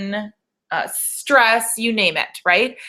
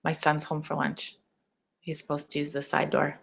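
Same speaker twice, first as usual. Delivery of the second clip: softer, hushed aside to the viewers; quiet aside; 5 semitones lower, 10 dB quieter than usual. Real, not acted. Coach on a webinar, hushed aside about her son coming home. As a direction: murmur it under your breath.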